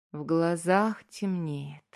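ordinary delivery, nothing marked

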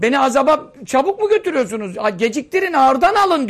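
dead man pleading. Acted.

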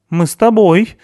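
loud, high, determined declaration; resolute. A narrator's reading. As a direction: announce it allowing no refusal. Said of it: "решительно сказала Туори". (решительно, громко и высоко)

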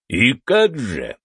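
slow, sneering taunt; drawn-out mocking question. A narrator's reading. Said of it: "глядя на него с издёвкой".

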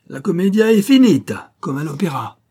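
high, loud operatic flourish, then easing; theatrical gloating. Acted. Officer ending the charade before the arrest, theatrical triumph. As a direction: proclaim it grandly, then drop off.

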